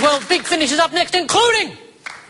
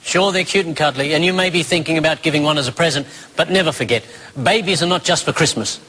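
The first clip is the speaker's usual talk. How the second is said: earnest appeal to viewers; sincere plea; 8 semitones lower than usual. Acted. Mock earnest PSA plea.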